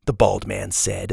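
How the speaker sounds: ordinary delivery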